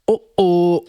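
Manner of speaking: high, loud oh oh of suspense; dramatic uh-oh. A narrator's reading. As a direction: sing out a worried oh oh.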